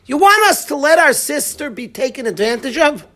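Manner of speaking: indignant retort. Retold or imitated. Sons' defiant retort to father.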